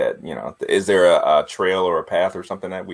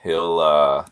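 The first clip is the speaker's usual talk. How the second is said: ordinary talk, nothing marked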